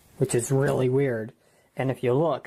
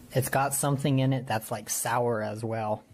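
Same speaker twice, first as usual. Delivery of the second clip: ordinary delivery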